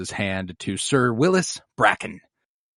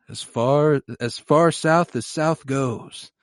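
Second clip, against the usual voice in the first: mock grand Jaime; 2 semitones higher, much slower than usual. Jaime's grand vague answer.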